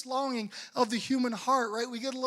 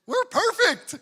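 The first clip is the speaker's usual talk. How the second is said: high, loud, beaming "we're perfect"; high-pitched, loud boast of a picture-perfect family; mock perfect family; 11 semitones higher, 9 dB louder than usual. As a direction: chirp it like a smug holiday card family. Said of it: "what's every family saying in their portrait?".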